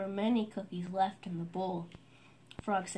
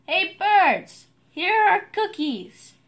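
much higher and louder call to the birds, slow on "Here are cookies"; loud shout; 10 semitones higher, 11 dB louder, slower than usual. A narrator's reading. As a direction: shout it across the yard to the birds. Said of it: "He shouted in a loud voice".

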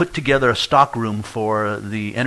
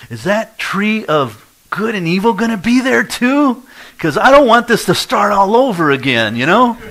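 put-on child asking Dad, high and loud; little boy; 11 semitones higher, 8 dB louder than usual. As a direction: ask like an anxious little boy. Small son asks question.

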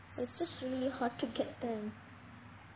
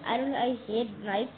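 usual speaking voice

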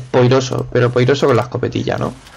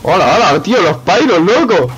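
excited shout; 6 semitones higher, 4 dB louder than usual. Real, not acted. Loud greeting in game.

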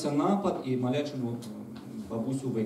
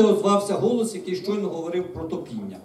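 plain reading voice

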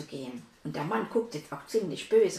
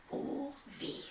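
whispered oh no; worried whisper; 7 semitones higher, 11 dB quieter than usual. Acted. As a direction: breathe it out in worry. Puppet fears for Hannah. (besorgtes Flüstern)

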